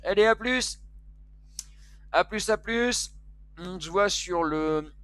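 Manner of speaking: loud, high send-off to the viewers; rousing sign-off. Real, not acted. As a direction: call out the goodbye with big energy. Signing off to viewers, launching the raid.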